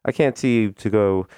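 ordinary talk, nothing marked

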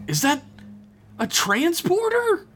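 high, urgent calling over the comms; a pinned-down alien pleading high; frantic Shran voice. Acted. Shran hears the transporter.

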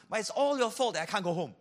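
a loud, rushed gripe; whiny complaint. Retold or imitated. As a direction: blurt it out as a fed-up grumbler. Acted quote of a complainer.

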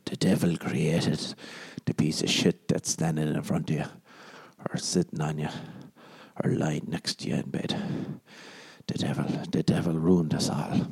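hushed sinister preaching; ominous low murmur. Acted. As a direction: murmur it like a dark sermon. Ominous devil sermon.

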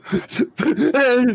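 high-pitched mock sobbing; pretend child's crying. Child breaks down over her friend, high pretend crying. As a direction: burst into a put-on little wail. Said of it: "Starts crying".

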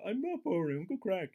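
whiny protest. Retold or imitated. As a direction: play a wounded simpleton pleading. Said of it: "He's like".

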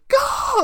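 sudden high yell of rage; frustrated yell. Retold or imitated. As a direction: burst out a short furious shout. Raging in car after work.

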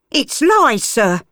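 put-on a harsh mother figure calling the child back; cockney woman. Gert threatens Flora from the steps, urgent.

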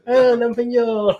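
slow high cutesy whine; drawn-out girlish baby talk. Retold or imitated. Mimicking cutesy girl.

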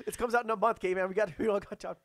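high, loud deadline pressure; harried boss. Acted. Boss warning writer of the release date, loud and urgent. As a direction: press them hard, no patience left.

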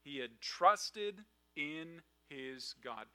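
very slow, every word weighed; slow emphasis. Acted. Preacher stressing Daniel's trust to the congregation, slow emphatic. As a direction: lean hard on "trusted", drawn out slowly. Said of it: "and get this".

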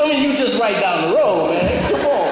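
loud, high mock exasperation; playful protest. Real, not acted. Teasing the student from down the road.

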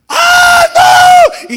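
very high, loud scream of panic; retold mother screaming in panic; panicked scream. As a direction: shriek as the car rolls away. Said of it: "Y la hermana".

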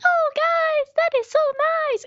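put-on very high, loud, sing-song baby talk; childlike high voice. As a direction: squeak it out like a cheery five-year-old. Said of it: "she talks like this".

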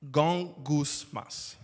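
gongusmas said slowly with a Spanish accent, GON-gus-mas; drawn-out Spanish-accented gongusmas. Shown as a demonstration. Preacher explaining the Greek word, in a Spanish accent. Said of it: "I say it with a Spanish accent".

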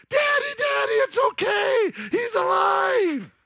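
put-on high, loud, slowed child's cry of joy; excited little girl. Daughter tells her dad Jesus is alive, high excited shout. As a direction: shout it like a thrilled five-year-old.

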